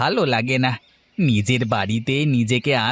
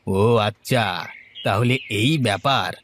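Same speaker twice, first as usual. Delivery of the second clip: slow realization; 4 semitones lower than usual. Acted. Echoes friend's words, slowly realizing.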